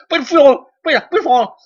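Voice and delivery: high, loud mocking shriek. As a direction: shriek it like a panicked crowd. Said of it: "Immediately that becomes".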